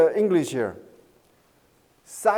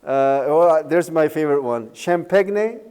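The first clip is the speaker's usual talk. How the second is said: usual speaking voice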